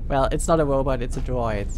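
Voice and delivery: ordinary delivery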